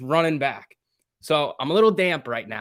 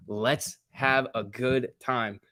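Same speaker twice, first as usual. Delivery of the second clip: slow drawn-out; 3 dB quieter, much slower than usual. Shown as a demonstration. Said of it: "slow drawn out catchphrase".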